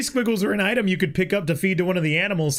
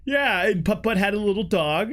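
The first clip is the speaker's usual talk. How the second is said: ordinary talk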